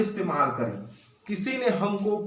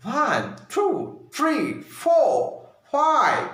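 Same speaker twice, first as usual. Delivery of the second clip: higher, from the chest, each number separate; American counting; 5 semitones higher, much slower than usual. Shown as a demonstration. Teacher to students, American-style counting. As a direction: count like a native speaker. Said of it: "ऐसा उन लोग की language होती है".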